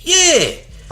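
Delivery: high, loud yell; whooping, high-pitched shout; excited yell. Real, not acted. Excited heckling of the other man.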